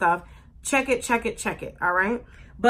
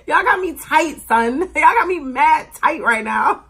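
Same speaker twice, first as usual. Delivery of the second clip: louder New York slang; 5 semitones higher, 7 dB louder than usual. Pretend. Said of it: "like they say in New York".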